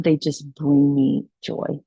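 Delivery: ordinary talk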